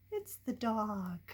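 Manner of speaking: ordinary delivery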